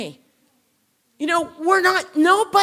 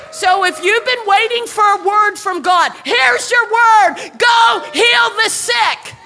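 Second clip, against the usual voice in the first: loud, high full-force preaching, ringing out; 7 semitones higher, 5 dB louder than usual. Real, not acted. Preacher commissioning the born-again to heal the sick, loud full-force preaching. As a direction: proclaim it high and loud to the whole room.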